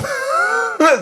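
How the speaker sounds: a sudden high laugh bursts out; high burst of laughter. Real, not acted. Dad laughing at his own hasty exit, high burst. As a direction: laugh at your own story, high and short.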